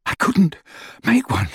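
out of breath, between gasps; panting. A narrator's reading. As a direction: gasp it out, winded. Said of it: "he panted".

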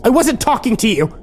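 high, angry snap at someone interrupting; yelling. A narrator's reading. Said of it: "Kimber yelled at me".

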